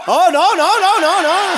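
high, rippling string of refusals; playful high-pitched objection; mock protest. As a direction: wail it in hammed-up protest. Acting out a reaction to blessing.

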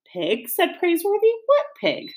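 innocent, rising, puzzled pig; feigned surprise. A narrator's reading. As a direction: play dumb with mock surprise.

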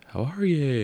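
mock showman hello to a silent hall; calling out to crowd. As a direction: sing it out like a comedian warming up a room. [name] greets indifferent crowd.

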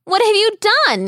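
high fast alarmed cry; boy crying out. A narrator's reading. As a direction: cry out in dismay. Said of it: "he cried".

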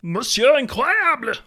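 high, slow hammy French; French accent. Acted. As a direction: roll it out grandly French. Mock French villain name.

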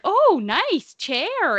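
put-on high, slow, delighted little-kid voice; imitating her son admiring the wheelchair, thrilled; excited toddler voice. Mother imitating toddler son praising chair, high and slow. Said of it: "he was so excited".

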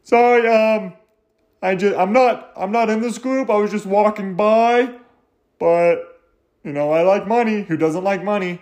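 high put-on voice; apologetic outsider, pitched well up; passer-by butting in. Acts out a passer-by excusing himself.

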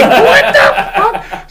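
high, slow, cracking up through the words; cracking up while retelling a weird DMV chat; laughing disbelief. Real, not acted. Retold reaction, laughing hard.

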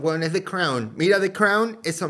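excitedly comparing it to The Crown. Real, not acted. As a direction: sell the comparison with enthusiasm.

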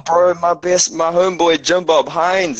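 usual speaking voice

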